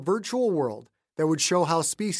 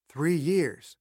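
plain reading voice

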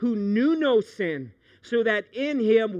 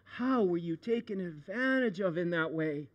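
sad; 2 semitones lower, 6 dB quieter than usual. Retold or imitated. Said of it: "a sadness in my heart".

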